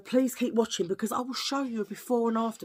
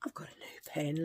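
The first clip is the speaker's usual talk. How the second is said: 1 hushed; 3 semitones lower, 9 dB quieter than usual. Pretend. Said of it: "laugh on the loo".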